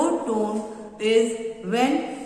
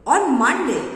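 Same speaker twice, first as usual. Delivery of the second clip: excited; 3 semitones higher, 6 dB louder than usual. Shown as a demonstration. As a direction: answer with excitement, pitch and volume raised. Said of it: "other person is very excited".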